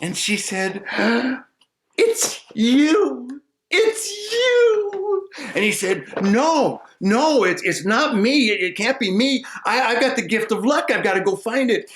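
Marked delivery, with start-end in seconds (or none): 0.9-1.4 sharp high gasp of surprise. Acted. Maiden gasping as she recognizes him. 2.0-5.3 much higher, rising joyful cry; overjoyed cry. Acted. Maiden recognizing her destined man, much higher joyful cry. 6.2-11.9 higher, stammering denial; flustered simple man. Acted. Simple man denying he is the one, higher and flustered.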